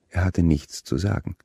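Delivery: plain reading voice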